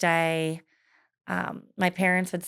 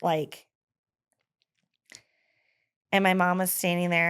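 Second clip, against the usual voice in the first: ordinary delivery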